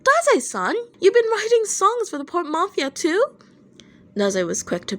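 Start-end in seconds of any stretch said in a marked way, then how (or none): 0.0-3.2 high, loud, eager outburst; a boy blurting out his shock, high and loud; crying out. A narrator's reading. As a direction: burst out in amazed excitement. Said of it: "Atsushi cried out".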